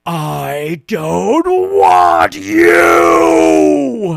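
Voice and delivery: drawn-out very loud tearful yell; crying shout. Retold or imitated. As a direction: scream it at daddy through tears. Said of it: "stopped mid-cry ... and went".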